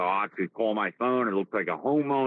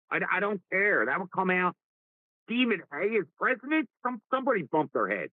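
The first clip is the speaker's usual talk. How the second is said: higher-pitched, scornful disbelief; incredulous rant; 8 semitones higher than usual. Real, not acted. Host scoffs at Stephen A for president, incredulous. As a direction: argue in raised pitch, as if it's absurd.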